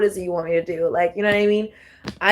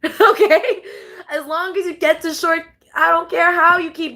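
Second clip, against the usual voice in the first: laughing; 8 semitones higher than usual. Real, not acted. Laughs while teasing the listeners.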